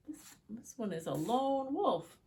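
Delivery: ordinary delivery, nothing marked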